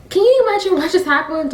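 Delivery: high-pitched indignant outburst, imitated; furious. Haman complaining to friends and family, acted fury. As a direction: pitch it way up in mock outrage. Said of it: "Haman went home angry. Like, he was furious".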